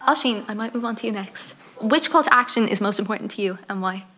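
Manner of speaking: ordinary talk, nothing marked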